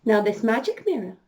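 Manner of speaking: ordinary delivery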